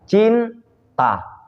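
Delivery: "Cinta" sung with a round open vowel. Shown as a demonstration. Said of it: "Vokal kan bulat kan?".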